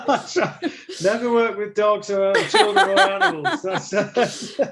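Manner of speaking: talking through a high giggle; amused chuckle through the line; laughing. Real, not acted. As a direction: laugh the words out. Joke about dogs upstaging actors.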